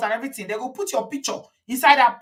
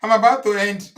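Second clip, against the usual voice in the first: ordinary talk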